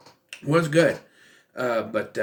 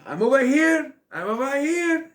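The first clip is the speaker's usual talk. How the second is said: high-pitched retold scream; mock screaming; 11 semitones higher than usual. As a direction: imitate someone yelling to be found. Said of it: "he's screaming".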